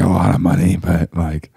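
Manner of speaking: low croaky imitation; mock hoarse voice. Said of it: "you're like".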